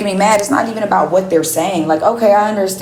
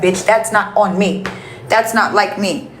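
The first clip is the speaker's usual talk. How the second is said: normal talking voice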